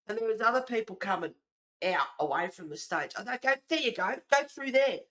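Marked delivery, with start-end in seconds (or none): 3.4-5.0 high, raised-voice send-off; loud retold directing. Streamer retelling sending pushers elsewhere, loud directing. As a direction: wave them through loudly.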